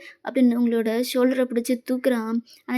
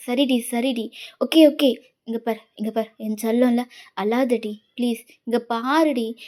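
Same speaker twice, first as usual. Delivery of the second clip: tender hushing; 5 semitones lower than usual. Acted. Boyfriend soothing his sobbing girlfriend, low and tender. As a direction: hush her like a child. Jimmun hushes her crying.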